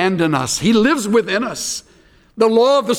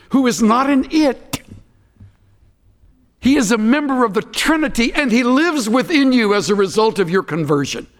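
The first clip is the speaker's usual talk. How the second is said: ordinary talk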